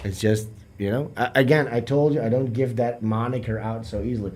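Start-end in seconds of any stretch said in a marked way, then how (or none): none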